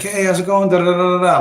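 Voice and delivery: high, sing-song imitation of small talk; mock chummy greeting. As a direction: mimic an unwelcome acquaintance saying hi. Mimicking the man's chummy greeting.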